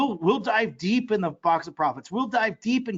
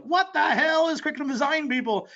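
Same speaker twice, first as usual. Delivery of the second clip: baffled outburst; 9 semitones higher than usual. Retold or imitated. His own confusion retold.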